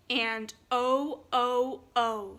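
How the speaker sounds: "O" said as a long rounded oh, loud; long o sound. Shown as a demonstration. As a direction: model the long "O" clearly. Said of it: "it makes two sounds".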